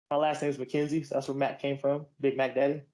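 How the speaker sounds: usual speaking voice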